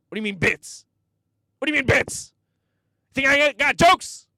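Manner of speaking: high, loud strained outrage; outraged old comic. Retold or imitated. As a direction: squawk in total disbelief. Donnell outraged impression.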